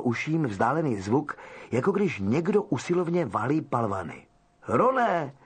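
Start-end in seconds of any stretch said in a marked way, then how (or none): 4.7-5.3 calling out. Acted. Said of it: "vykřikl Harry".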